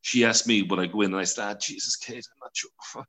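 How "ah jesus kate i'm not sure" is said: soft, breathy, unsure mumble; hesitant reply. Retold or imitated. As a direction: trail off into a reluctant half-whisper. Reluctant reply to daughter.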